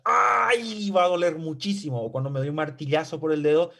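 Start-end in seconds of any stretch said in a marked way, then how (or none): 0.0-0.9 sudden high "¡Ay!" of a pinprick; mock cry of pain. Teacher acts out a pinprick, a high mock "¡Ay!". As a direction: yelp "¡Ay!" as if stung by a pin. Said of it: "yo voy a gritar".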